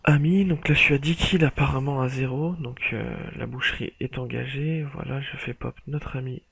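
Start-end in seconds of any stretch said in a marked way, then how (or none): 0.0-2.5 loud, pumped-up boast; excited. Real, not acted. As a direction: shout the kill count with glee. Boasting mid-game, kill streak.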